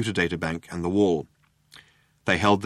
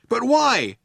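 high-pitched raised demand; Trillian demanding; 4 dB louder than usual. A narrator's reading. Trillian challenging the cops' reasoning, demanding. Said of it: "demanded Trillian".

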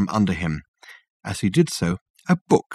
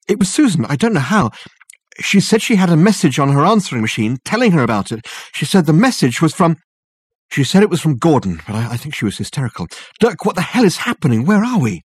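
panicked; 7 semitones higher, 3 dB louder than usual. Acted. Richard frantic, panicked.

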